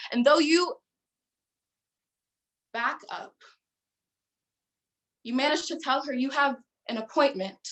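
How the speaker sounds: measured, charged recital of a prose poem; dramatic poetry performance. Acted. Poetry competition performer recites to judges, dramatic and measured. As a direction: perform it for judges, every pause weighted.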